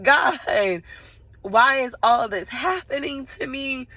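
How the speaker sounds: exasperated "why me" wail; retold tearful plea. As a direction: cry out as if overwhelmed, retelling it. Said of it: "I probably did cry".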